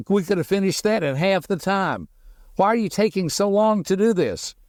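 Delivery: mock hurried husband prodding his wife; a husband voicing his urge to rush his wife, impatient; impatient nagging. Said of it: "something inside of me that wants to say".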